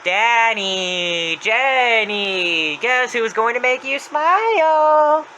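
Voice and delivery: screaming. Retold or imitated. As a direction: call through the house, stretching every name.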